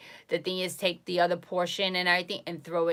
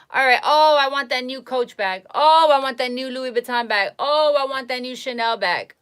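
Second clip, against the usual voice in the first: higher, whiny mock shopper wanting bags; mock greedy shopper; 4 semitones higher, 6 dB louder than usual. Mocking a shopper who wants every designer bag, whiny.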